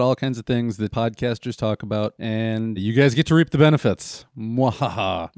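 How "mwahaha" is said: mock evil laugh, hammed-up; sneering panto villain. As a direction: cackle like a cartoon villain. Mock villain laugh after reap benefits.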